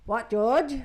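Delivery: loud, snappy "What, George?" with an edge; sarcastic snappy. Retold or imitated. Mother snapping at her daughter, sarcastic and loud. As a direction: snap at her daughter in irritation. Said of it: "sarcastic snappy".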